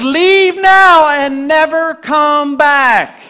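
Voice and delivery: high, loud, slow command; banishing command. Acted. Smeagol banishing Gollum, high, loud and slow. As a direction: cast him out with a slow, ringing order.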